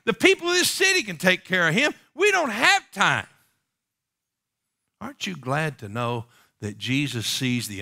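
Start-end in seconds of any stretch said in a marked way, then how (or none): 0.0-3.3 high, loud brush-off of the beggar; pitched-up impatient disciple voice; dismissive disciples. Acted. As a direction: wave him away, impatient.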